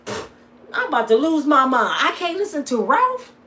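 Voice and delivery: high, loud mock outrage; squealing mock disbelief at Ralph; retold outrage. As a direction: act out the indignant fan. Retold outburst about the ticket.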